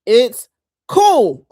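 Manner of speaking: high, loud and easy-going; breezy reassurance. Retold or imitated. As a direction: shrug it off brightly. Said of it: "I'd be like".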